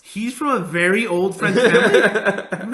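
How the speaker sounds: mocking a line he finds ridiculous; incredulous mockery. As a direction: drag it out like it's absurd. Mocking the film's claim.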